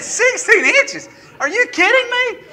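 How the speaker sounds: high mock-shocked squeal at a friend; incredulous disbelief. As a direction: act floored by the forecast. Retelling his shock at the forecast.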